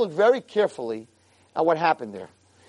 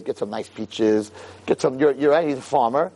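ordinary delivery

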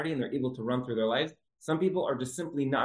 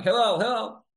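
raised, higher-pitched call; mock screaming out; 7 semitones higher, 4 dB louder than usual. Man outside yelling for Hillel in the bath, mock shout. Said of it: "this man starts screaming out".

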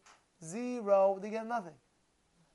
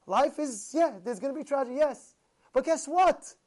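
ordinary talk, nothing marked

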